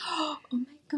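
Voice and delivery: high, breathy gasp of surprise; surprised. Retold or imitated. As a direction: react as if just seeing it. Shocked at daughter's short hair.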